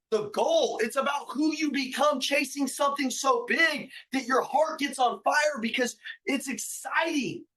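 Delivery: rally the crowd like a sermon; preaching at full force. Acted. Fiery motivational lion speech.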